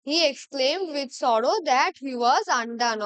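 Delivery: very slow, each word laid out for the class; slow dictation. Shown as a demonstration. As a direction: read it out slowly like dictation. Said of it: "দেখো".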